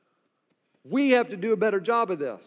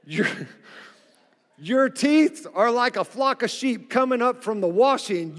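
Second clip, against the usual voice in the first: ordinary talk, nothing marked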